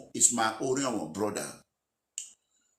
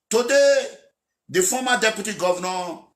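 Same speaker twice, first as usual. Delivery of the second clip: much higher and louder on one word; heated shout; 7 semitones higher, 6 dB louder than usual. Real, not acted. Aspirant railing against his former ally, a sudden shout. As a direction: fling the word out in outrage.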